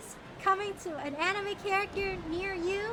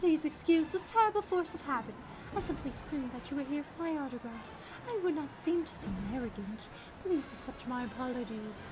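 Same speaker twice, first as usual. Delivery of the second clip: softer, lower gentleman; polite character voice; 5 semitones lower, 7 dB quieter than usual. Acted. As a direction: apologise like a humble star.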